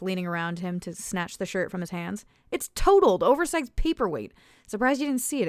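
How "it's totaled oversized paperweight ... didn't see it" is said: high, loud angry rant. A narrator's reading. Alice rants at Oswin about her car, angry. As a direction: vent at him.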